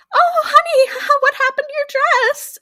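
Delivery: very high, hammed-up mom voice with a laugh; doting mother. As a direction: coo it way up high, mock concern. Voicing mother to bloodied little girl.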